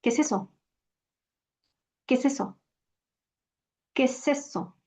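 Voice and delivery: "¿Qué es eso?" run together like "quesesso"; fuse the vowels, one flowing word; quick, soft native question; natural blended speech. Shown as a demonstration. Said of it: "Another basic question is".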